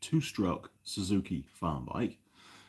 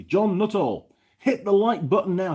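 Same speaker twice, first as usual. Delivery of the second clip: calling out; 11 semitones higher, 7 dB louder than usual. Real, not acted. Shouting out to a viewer.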